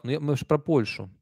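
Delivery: ordinary talk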